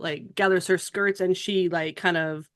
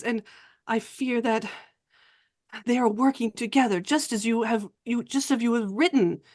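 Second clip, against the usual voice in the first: put-on slightly raised French-accented voice, halting; earnest, fearful Frenchwoman; 3 semitones higher than usual. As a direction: confide a fearful warning with pauses. Plays a fearful French woman.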